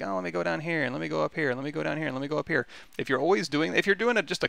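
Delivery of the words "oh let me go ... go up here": breathless sing-song of endless clicking; hurried mock self-talk. Teacher mocking a student who keeps clicking: fast, light mock chatter. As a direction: rattle it off fast, half-mocking, a little higher.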